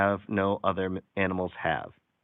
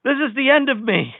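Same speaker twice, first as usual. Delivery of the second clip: a much higher, squeaky pig voice; pig's last words; 12 semitones higher, 4 dB louder than usual. Acted. Reading a joke punchline to viewers in a high pig voice. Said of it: "The pig said".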